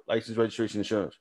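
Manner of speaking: normal talking voice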